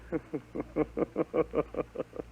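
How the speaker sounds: a breathy disbelieving chuckle; soft laugh. Acted. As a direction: laugh it off under your breath. Father laughs at son's claim.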